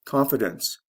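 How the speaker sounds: "Confidence" stressed on CON; teacher's model of "Confidence", beat on CON; stress on first syllable. Shown as a demonstration. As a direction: lean on the opening CON of "Confidence". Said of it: "First syllable to third syllable".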